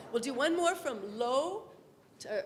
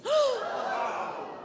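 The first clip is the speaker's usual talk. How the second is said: high gasp with a laugh; big surprised gasp; 10 semitones higher than usual. Shown as a demonstration. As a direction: gasp like a lion face. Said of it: "Another lion face".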